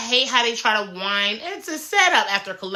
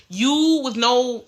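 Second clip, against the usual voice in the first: usual speaking voice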